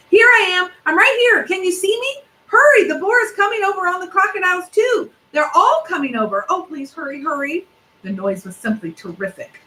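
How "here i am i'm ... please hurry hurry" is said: dragon yelling. A narrator's reading. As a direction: call out high and urgent for rescue.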